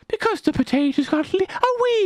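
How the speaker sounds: very high, cutesy Irish voice; mocking, babyish high pitch; mock-Irish baby talk. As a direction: squeak it like a pitiful little villager. Mocking Irish potato villagers.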